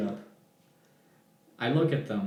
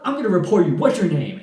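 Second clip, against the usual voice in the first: high, louder threat; threatening; 6 semitones higher, 8 dB louder, faster than usual. Retold or imitated. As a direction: demand his name to report him. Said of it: "she was cussing at me".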